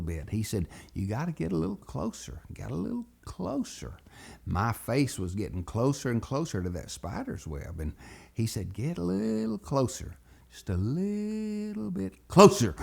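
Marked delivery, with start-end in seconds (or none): 0.9-4.0 high, soft, quick coaxing; sly coaxing. Retold or imitated. Buddy pushes two boys toward the spider's web, coaxing. 8.7-12.8 coaxing, building. Retold or imitated. Buddy coaxes the boys before shoving them in, building.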